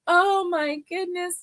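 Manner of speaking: high, drawn-out mock wail; retold crying. Woman retelling her crying over the finger, mock-tearful. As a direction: whimper it as if crying. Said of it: "like, crying".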